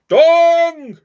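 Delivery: long high loud bong; clock chime. A narrator's reading. Clock striking midnight, a long loud bong. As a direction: ring it out like a big clock bell.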